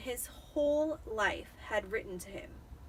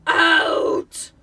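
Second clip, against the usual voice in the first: much louder, higher roared order; 7 semitones higher, 16 dB louder than usual. Acted. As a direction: roar them out of the room. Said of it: "roared Uncle Vernon".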